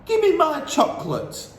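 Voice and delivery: put-on high, loud boy's demand; Bruno demanding. A narrator's reading. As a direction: insist on the chocolate.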